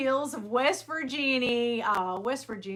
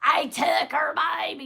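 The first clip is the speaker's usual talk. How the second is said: quoted meme; 5 semitones higher, 6 dB louder than usual. Retold or imitated. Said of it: "the wonderful".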